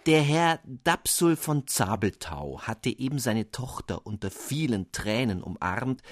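ordinary delivery

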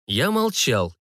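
plain reading voice

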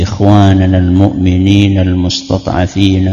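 lightly intoned supplication in Arabic; prayer recitation. Shown as a demonstration. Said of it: "Biasanya kan Ustaz doanya".

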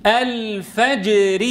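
very slow الفجر, hard voiced stop on ج; strong voiced jeem. Shown as a demonstration. Teacher models for repetition, slow firm ج in الفجر. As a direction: stretch الفجر and shut firmly on the ج. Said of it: "احرص على الشدة والجهر".

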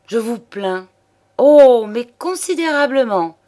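high, gushing false pity; mock sympathy. A narrator's reading. Mistress pities her hard-up lover, mockingly. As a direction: swell loudly into exaggerated commiseration.

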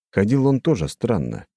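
plain reading voice

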